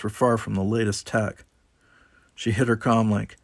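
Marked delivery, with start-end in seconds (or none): none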